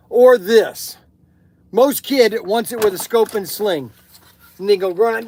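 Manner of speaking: loud, punchy sales pitch. Acted. Shop owner pitching his toy guns in an upbeat ad read. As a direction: sell it like a radio spot.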